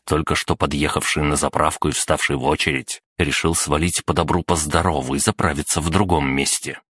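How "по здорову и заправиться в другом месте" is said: ordinary delivery, nothing marked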